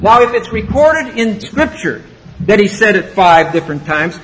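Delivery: ordinary delivery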